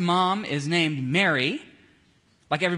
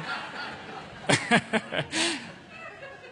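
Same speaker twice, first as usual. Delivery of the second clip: a high, drawn-out laugh; helpless real laughter; long real laugh; 11 semitones higher than usual. Real, not acted. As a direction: laugh at your own joke. Laughs at own angel impression.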